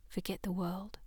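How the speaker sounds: soft whisper with a rising end. Acted. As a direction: whisper it intimately. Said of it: "she whispered".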